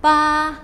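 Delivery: "Ba" held high and level; first tone. Shown as a demonstration. Teacher practising syllables in four tones, "Ba" high and level. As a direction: keep "Ba" on one high, steady pitch. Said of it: "in each of the four".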